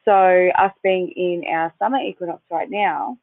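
normal talking voice

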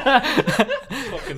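high laughing through words; laughing fit. Real, not acted. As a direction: laugh so hard the word breaks. Laughing at the answer.

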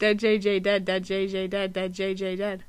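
low, quiet, quick repeats of "dead J J dead"; fast tongue twister. Shown as a demonstration. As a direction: rattle off "dead J J dead", run together. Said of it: "try to say it ten times fast".

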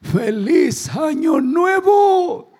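high, very slow, hammed-up old man yelling; old man's shout. Grandpa in the rocking chair shouting to his family, hammed-up and slow. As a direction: belt it like a confused grandpa. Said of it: "dice el viejito".

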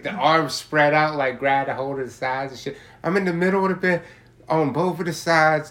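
loud, high and worked up, reliving how high he got; excited retelling. Real, not acted. Telling his friend about the edible high, loud and excited.

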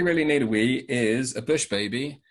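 usual speaking voice

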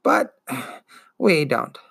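slow, dragging, wheezing ancient voice; wheezing old turtle. Acted. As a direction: drawl it out like a weary old beast. Turtle voice continues.

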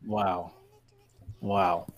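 ordinary talk

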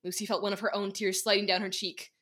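ordinary delivery

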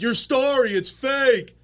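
high-pitched heckling; heckler's voice. Retold or imitated. Heckler to comic on stage, high insistent call. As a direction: push it up high like a crowd member.

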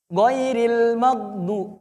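"Ghairil magdu" drawn out slowly; slow recitation. Shown as a demonstration. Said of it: "Jangan mage".

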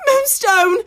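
high frantic call; crying out. A narrator's reading. Farmer woman calling for her lost hen, frantic high call. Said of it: "she cries out".

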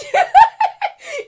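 a real cackle at her own joke; loud high laugh. Real, not acted.

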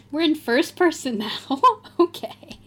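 usual speaking voice

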